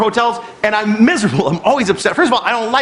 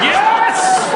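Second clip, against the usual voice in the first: delighted shout. Retold or imitated. Guest cheering the manager's investigation, high shout. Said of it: "I was like".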